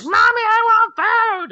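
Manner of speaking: put-on high, drawn-out loud child's wail; screaming little kid. Little kid overheard on a game mic, screaming for mommy. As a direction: shriek it like a demanding small child. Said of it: "you'll hear little kids running around the room".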